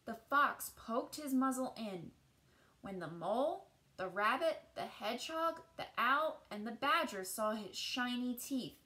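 put-on gentle read-aloud voice for children; storybook narration. A narrator's reading. Teacher reading a picture book to children, gentle storytelling. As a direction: tell the tale as if to a class at storytime.

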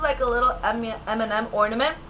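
ordinary talk, nothing marked

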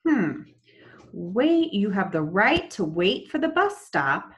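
ordinary delivery

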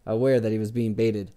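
ordinary delivery

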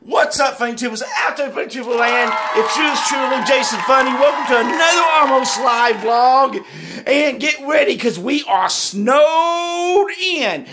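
excited intro. Acted. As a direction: shout the welcome like a showman. Hyped vlog intro to fans.

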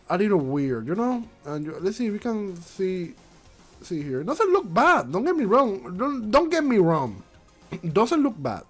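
ordinary talk, nothing marked

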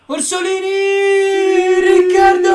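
breathless italian goal commentary. Real, not acted. Goal build-up, commentating own game.

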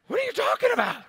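very high-pitched, disbelieving protest; incredulous retort. Retold or imitated. Pastor retelling his reply to critical leaders, incredulous retort. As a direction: throw it back in high disbelief.

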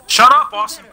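loud, high annoyed snap. Real, not acted. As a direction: shut him up sharply. Snaps at noisy teammate.

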